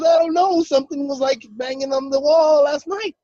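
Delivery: high-pitched retold protest; defensive denial. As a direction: protest your dog's innocence to your uncle. Defensive answer to angry uncle.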